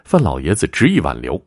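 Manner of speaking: ordinary delivery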